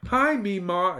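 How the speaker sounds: put-on high, childish greeting; exclaiming little girl. A narrator's reading. Hilary greets grandmother at her bedside, exclaiming. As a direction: greet in a bright girlish pitch. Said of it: "exclaimed Hilary".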